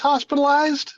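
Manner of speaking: high, disbelieving repeat of hospitalized; hospitalized? — переспросить высоко, с недоверием; incredulous echo. Real, not acted. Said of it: "were you hospitalized".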